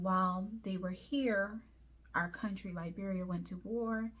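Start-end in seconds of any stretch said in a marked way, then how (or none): none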